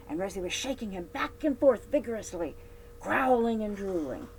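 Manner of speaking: usual speaking voice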